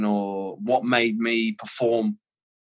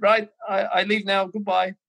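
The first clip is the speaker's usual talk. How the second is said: put-on higher-pitched Scouse German accent, matter-of-fact; imitating Gravesen's Scouse German accent, leaving; 8 semitones higher than usual. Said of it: "he's got this weird Scouse German accent going on".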